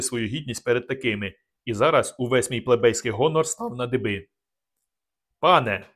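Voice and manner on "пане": a loud, firm "Пане"; loud address. A narrator's reading. Narrator confronting the nobleman, loud and firm. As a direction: call him out loudly. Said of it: "голосно сказав я".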